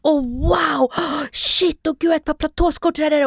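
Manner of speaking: breathless, gushing sales pitch with a gasp; gushing shop assistant. Retold or imitated. Shop assistant pushing platform shoes, gushing excitedly. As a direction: gush over the outfit like an eager saleswoman. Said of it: "Och hon i butiken".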